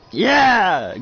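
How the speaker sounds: sudden high, loud yell of joy; excited cheer. Acted. Crowd at the baseball game cheers as Connor catches, loud. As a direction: burst out cheering.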